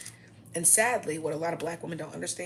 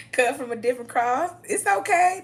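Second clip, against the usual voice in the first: much higher and louder, triumphant; defiant, loud; 9 semitones higher, 9 dB louder than usual. Acted. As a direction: crow it over the haters. Defiant boast to haters.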